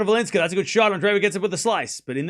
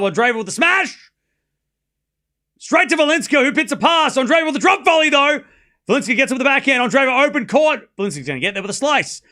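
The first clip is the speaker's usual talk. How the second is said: higher, louder shouting rally call; excited play-by-play; 5 semitones higher, 4 dB louder than usual. Acted. Streamer calling a wild rally to chat, excited shouting. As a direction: ramp up and shout the action.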